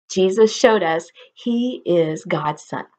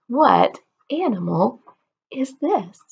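ordinary talk

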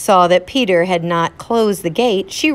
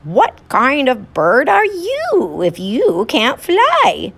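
high, slow teasing little bird; 7 semitones higher, slower than usual. Acted. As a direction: taunt like a cheeky bird. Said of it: "said to the duck".

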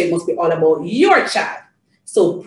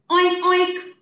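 two high, short oinks; pig oink; 9 semitones higher than usual. Shown as a demonstration. Telling Janie what the pig says, high oinking. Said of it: "The pig says".

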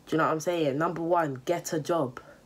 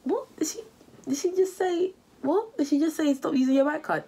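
much higher squeaky double take; mock disbelief; 11 semitones higher than usual. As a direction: play the shocked viewer, pitch way up. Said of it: "you're like".

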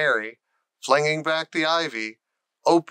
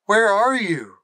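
higher, louder plea; pleading; 7 semitones higher, 5 dB louder than usual. Acted. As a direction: call out longing into the dream. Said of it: "he pleaded".

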